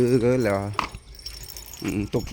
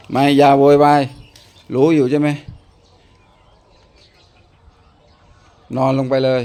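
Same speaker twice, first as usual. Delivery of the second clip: loud, higher stern scolding; scolding the dog; 4 semitones higher, 10 dB louder than usual. Real, not acted. Owner telling his noisy dog to lie down, loud stern scold. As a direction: tell the dog off firmly and loud.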